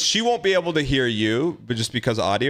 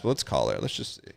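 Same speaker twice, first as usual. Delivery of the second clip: hushed; 13 semitones lower, 7 dB quieter than usual. Real, not acted. About to prank-call a guest.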